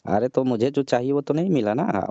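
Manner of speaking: normal talking voice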